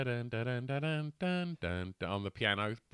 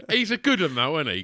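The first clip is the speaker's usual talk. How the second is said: laughing; 5 semitones higher, 11 dB louder than usual. Real, not acted. Laughing through a joke.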